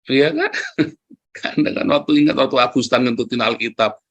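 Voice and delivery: ordinary talk, nothing marked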